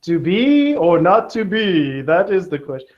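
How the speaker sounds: lightly hammed Shakespearean recital; mock Shakespeare. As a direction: declaim it like a hammy Shakespeare. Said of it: "William Shakespeare said".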